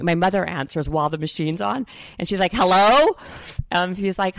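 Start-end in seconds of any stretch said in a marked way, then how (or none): 2.5-3.2 louder mock hello down the line; loud phone hello. Mother answering the ringing phone, louder mock hello.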